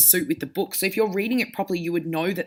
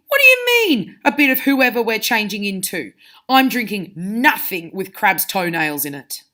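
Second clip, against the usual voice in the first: sharp; 2 semitones higher, 6 dB louder than usual. Shown as a demonstration. Teacher acting Ron objecting to the potion, sharply. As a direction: snap it back, outraged. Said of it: "said Ron sharply".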